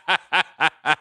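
laughing what; a surprised laugh-out question; laughing disbelief. Retold or imitated. Surprised by Tiffany's offer.

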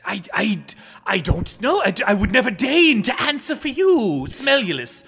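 put-on high, stammering old courtier; old adviser, flustered. Adviser asked what the emperor wishes, flustered and deferential. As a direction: protest deferentially, tripping over the start. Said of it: "What do I wish, Whisperia".

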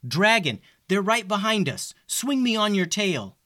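high urgent shout to the dragon; yelling. Acted. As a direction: call out high in a hurry. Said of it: "yelled Simon".

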